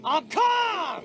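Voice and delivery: high, loud shout. Acted. Passenger shouting at the marshal that he's calm. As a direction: yell it, ironically not calm.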